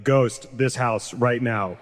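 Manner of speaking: slow, deliberate answer; slow firm choice. Retold or imitated. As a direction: say it slowly, dead certain. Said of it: "I'd be like".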